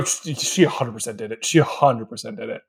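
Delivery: low, quiet verdict; deadpan accusation. Acted. Co-host naming the obvious killer, low and certain. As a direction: drop low and soft, sure of the culprit.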